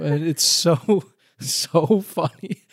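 slow, laughing through the words; giggling as she says it. Real, not acted. Friend laughing while telling a funny scene.

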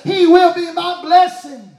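loud sermon build; fiery sermon run; preaching at full force. Acted. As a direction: build the list to the rafters.

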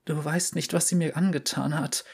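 fragile voice after the smoke; weak and hoarse. Acted. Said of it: "ihre Stimme schwach und heiser". (schwach und heiser, leiser)

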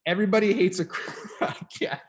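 cracking up mid-word; laughing while trying to read. Real, not acted. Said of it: "I can't even read it without laughing".

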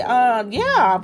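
ordinary talk, nothing marked

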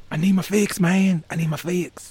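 high-pitched imitation of a desperate addict; mock pleading for a fix; Pookie. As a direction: beg in a high, put-on junkie voice. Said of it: "gonna be like Pookie in New Jack City".

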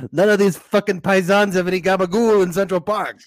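lightly voiced mobster gripe; Sopranos accent. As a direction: grumble like a Jersey mobster. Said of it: "Tony Sopranos".

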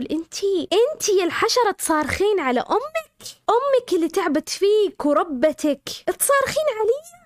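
angry mother. Retold or imitated. Mother scolding her daughter.

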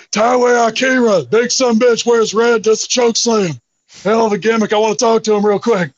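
hammed-up Stone Cold phone promo; Steve Austin impression. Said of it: "I did my Steve Austin impression".

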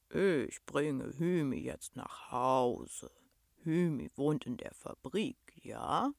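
low, slow, kindly beast voice; rumbling monster. A narrator's reading. Monster offers to carry the girl home, slow deep rumble. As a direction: rumble it deep, lifting into a question.

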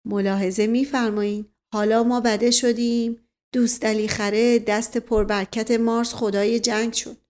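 plain reading voice

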